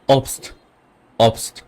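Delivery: obst said 'opst', B as P; short O in obst, ending in crisp 'pst'; German pronunciation. Shown as a demonstration. Teacher gives an example for O, obst as 'opst'. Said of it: "ওকে আপনি ওই বলবেন".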